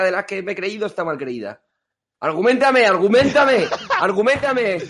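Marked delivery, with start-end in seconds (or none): 2.2-4.8 loud laughing demand; shouting. Acted. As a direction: yell it at them, half laughing. Mock demand for arguments.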